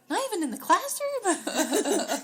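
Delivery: high loud disbelief; disbelieving. Retold or imitated. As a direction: push it up in disbelief. Mom pressing daughter, rising.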